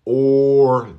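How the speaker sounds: or held long and loud, tongue not touching; drawn-out or. Shown as a demonstration. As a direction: stretch out or with an open mouth. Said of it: "this is the hard part".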